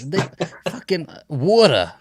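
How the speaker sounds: high-pitched mock Jersey accent. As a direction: punch out an exaggerated Jersey accent. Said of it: "what's a Jersey accent sound like?".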